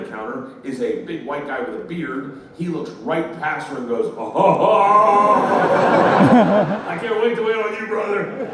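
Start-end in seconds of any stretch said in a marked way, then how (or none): 4.2-8.5 loud, high booming greeting with a big ho-ho laugh; boisterous clerk. Retold or imitated. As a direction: play the overjoyed counter guy. Acts out clerk greeting her jovially.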